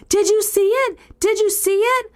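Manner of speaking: frantic high-pitched screaming; screaming child. Retold or imitated. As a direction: shriek the question in panic.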